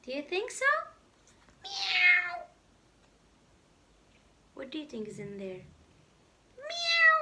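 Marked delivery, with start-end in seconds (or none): 1.6-2.4 very high meow; cat meow. Acted. The hidden cat meows at Spot. 6.6-7.2 very high meow; cat meow. Acted. The hidden cat meows at Spot.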